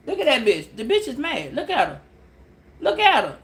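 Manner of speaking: usual speaking voice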